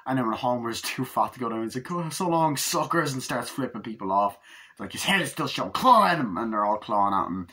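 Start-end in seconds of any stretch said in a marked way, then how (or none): 1.9-3.1 high, cocky put-on taunt; gloating farewell. Father taunts the mob from the sinkhole, high gloating. 5.7-6.4 high, loud mock order; mob shout. Mob member urging others onto the escaping man, high shout.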